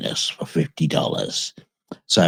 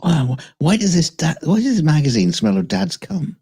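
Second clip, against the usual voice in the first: put-on puzzled kid; 10 semitones higher than usual. Kid voice finding old magazine.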